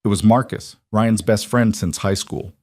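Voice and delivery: ordinary delivery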